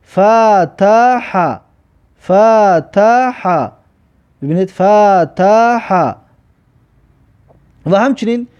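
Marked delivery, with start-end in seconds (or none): 0.1-6.1 فتح drawn out very slowly; slow demo pronunciation. Shown as a demonstration. Teacher sounds out فتح very slowly for pupils. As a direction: stretch فتح slowly for the class.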